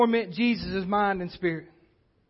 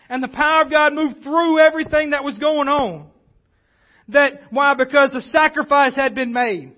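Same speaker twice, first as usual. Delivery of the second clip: preaching louder, climbing toward the climax; preacher building power to his congregation, louder and rising; 3 semitones higher, 4 dB louder than usual. Acted. Pushing the sermon up in force and pitch.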